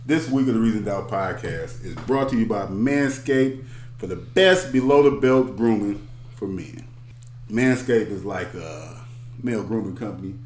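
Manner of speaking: ad read. Acted.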